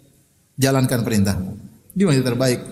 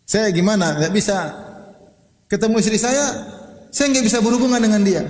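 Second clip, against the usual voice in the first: higher-pitched imitated lament; complaining; 7 semitones higher than usual. Friend confiding marital trouble to the ustaz, high plaintive complaint. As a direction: plead helplessly, pitched up. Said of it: "tempat banyak keluhan".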